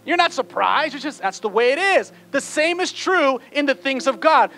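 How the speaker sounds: loud, high, driving exhortation; full-force preaching. Real, not acted. Preacher rousing church to expect traffic on the way, fervent.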